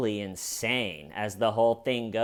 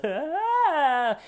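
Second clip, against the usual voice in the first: high, breathy mock cry of shock; fake amateur gasp; 14 semitones higher than usual. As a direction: overact a cheap fake gasp. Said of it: "sounds a little bit like an amateur actor faking".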